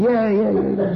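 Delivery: high, playful "yeah yeah" of gods at a party; partying Devas. Retold or imitated. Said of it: "the Devas are going".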